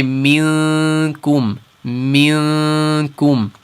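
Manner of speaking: slow "minkum" with the nasal at the front; light ikhfa. Shown as a demonstration. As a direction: keep "minkum" light and forward. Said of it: "The kaf is in the front".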